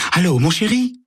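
high, honeyed phone voice; unrecognizable sweet voice. Acted. Carole calls her secret lover, high sugary voice. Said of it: "d'une voix que Sam a du mal à reconnaître".

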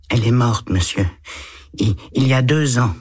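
put-on a small boy answering a policeman shyly; little boy. A narrator's reading. Eight-year-old boy answers the lieutenant, timid child voice.

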